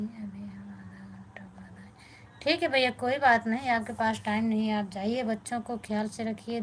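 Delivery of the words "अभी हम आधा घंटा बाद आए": hushed whisper aside, off the mic. Real, not acted. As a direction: whisper it quickly so the viewers barely catch it. Whispered aside to someone nearby.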